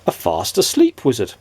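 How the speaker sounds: plain reading voice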